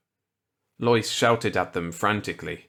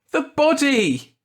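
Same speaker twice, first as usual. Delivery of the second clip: high, loud, desperate scream; 7 dB louder than usual. A narrator's reading. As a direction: scream it as they hold you back. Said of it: "Lois screamed".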